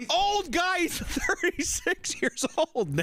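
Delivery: high, drawn-out protest through laughter; incredulous outburst. Real, not acted. Correcting cohost on driver's age, high incredulous protest. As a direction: squeal it in real disbelief, stretching the age. Indignant at being called old.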